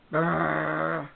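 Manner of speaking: rolled lips buzzing like a saw; buzzsaw noise. Retold or imitated. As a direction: imitate a buzzsaw with a trilled brrr. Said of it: "What does your laser sound like?".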